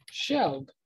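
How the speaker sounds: "shelled" said as one syllable, with a clear L; old pronunciation. Shown as a demonstration. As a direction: say "shelled" with the L sounded fully. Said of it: "was originally pronounced".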